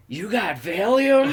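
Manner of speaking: high, loud, drawn-out imitation of a coworker; eager lady coworker. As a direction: pipe up as an eagerly interested lady, stretching it out. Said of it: "So this lady".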